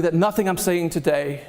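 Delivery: ordinary talk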